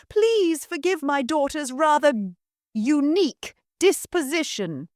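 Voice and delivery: syrupy welcome for the lord; simpering hostess. Acted. Mother apologises.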